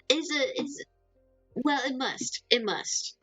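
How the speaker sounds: ordinary talk, nothing marked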